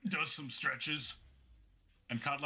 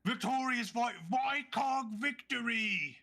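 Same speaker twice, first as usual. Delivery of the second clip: high, projected fight-night call; ring announcer; 7 semitones higher, slower than usual. Acted. Announcer addresses the crowd after the fight, high showy call. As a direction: hype up the arena crowd.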